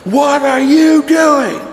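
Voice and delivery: high, slow drill-sergeant yell. Retold or imitated. Sergeant yelling at a recruit still wearing his scapular, high slow yell. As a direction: bark it like an angry sergeant in a recruit's face. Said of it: "He just starts yelling and yelling".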